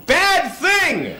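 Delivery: short, high shouted alarm; quick warning yell. Retold or imitated. Yelling out to the cyclist, short high alarm. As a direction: blurt it out as a warning. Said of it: "I just yelled out".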